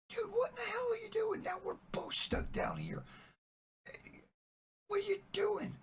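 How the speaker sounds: loud, very high squeal of disbelief; hammed-up aghast yell. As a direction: shriek at a friend in mock outrage. Trapped man aghast friend jumped in.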